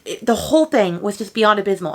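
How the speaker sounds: usual speaking voice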